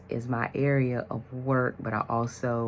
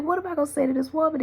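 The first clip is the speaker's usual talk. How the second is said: flustered panic; 4 dB louder, much faster than usual. Retold or imitated. Panicking about calling the woman.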